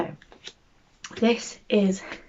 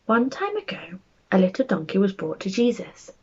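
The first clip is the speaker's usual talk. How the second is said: lively, animated storybook narration, rising higher as the crowd grows; bedtime story reading; 3 semitones higher than usual. A narrator's reading. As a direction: read it like a picture book to small children. Reads a bedtime story aloud.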